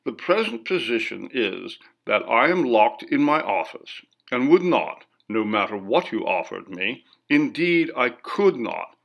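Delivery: ordinary delivery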